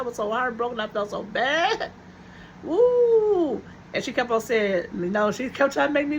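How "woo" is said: high whoo exhale. Real, not acted. Exhaling after emotional story.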